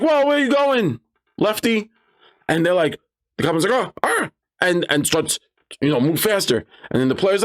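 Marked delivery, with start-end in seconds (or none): 0.0-1.9 high-pitched surprised player calling after someone; startled call. Retold or imitated. Player catches goblin sneaking off. 3.7-4.3 high, startled goblin noises; caught out. Acted.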